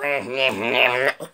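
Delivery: deep, slow villain laugh; low menacing doll cackle; evil laugh. Acted. Chucky gloating after the threat, deep evil laugh.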